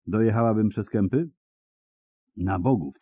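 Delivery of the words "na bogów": usual speaking voice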